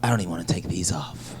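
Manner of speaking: breathy mock-seductive whisper; hushed, unvoiced lust. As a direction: whisper it like a horny hooper. Comic acts lustful guy, whispering.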